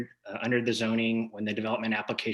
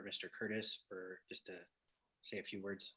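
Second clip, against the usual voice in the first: faint and fading; barely audible; 17 dB quieter than usual. Real, not acted. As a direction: trail off almost silent.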